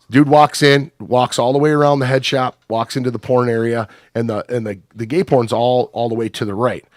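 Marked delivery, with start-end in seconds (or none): none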